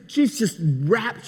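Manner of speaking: ordinary talk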